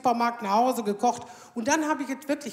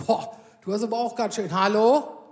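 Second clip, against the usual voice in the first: leicht gespielter Arzt, am Ende lauter „hallo?“; 2 semitones lower, 5 dB louder than usual. Retold or imitated. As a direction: tadelnder Arzt, der staunt. Arzt kommentiert ihr Gewicht. (gespielter Arzt, vorwurfsvoll erstaunt)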